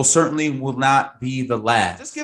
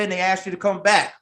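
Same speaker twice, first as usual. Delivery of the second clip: ordinary talk, nothing marked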